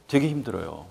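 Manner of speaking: usual speaking voice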